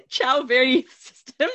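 slow, hammed-up Italian accent; shitty Italian accent. Shown as a demonstration. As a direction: draw it out in a bad Italian accent. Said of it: "with a shitty Italian accent".

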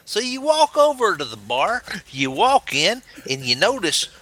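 high, swooping Southern US storyteller; Southern American accent. Acted. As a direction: spin a yarn in an Alabama accent. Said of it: "Southern American accent".

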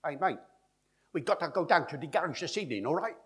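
high, loud Cockney, every syllable punched evenly; syllable-timed Cockney. Shown as a demonstration. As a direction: rattle it off in fast machine-gun Cockney. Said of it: "They speak like this".

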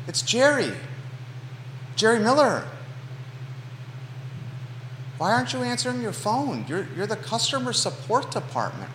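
mock sweet voicemail. Leaving pointed voicemail for company.

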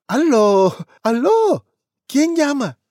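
voz de abuela muy aguda y fuerte; grandma on phone. Acted. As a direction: contesta el teléfono como una abuelita. Abuela contesta el teléfono. (la abuela contesta el teléfono con voz muy aguda)

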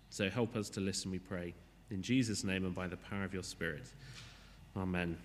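low, soft prayer voice; hushed opening prayer, pitched low. Real, not acted. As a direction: pray quietly in short, measured phrases.

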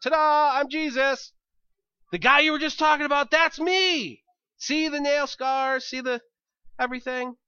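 high, loud, hammed-up announcement; showy big reveal. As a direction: play a triumphant game-show surprise entrance. Jesus's big reality-show style reveal.

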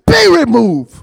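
commanding decree. Pretend. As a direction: order the mountain away. Speaking to the mountain.

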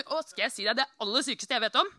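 ordinary delivery

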